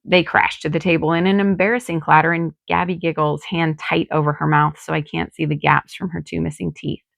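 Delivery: usual speaking voice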